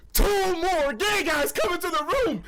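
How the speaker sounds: high, half-laughing disbelief; incredulous punchline. Real, not acted. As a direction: deliver it like you can't believe it. Escalating punchline of story.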